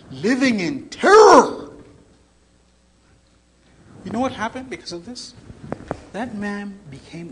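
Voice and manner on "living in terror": slow, high, loud cry; imitated terror. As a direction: stretch it into an anguished cry. Acted confession of constant fear.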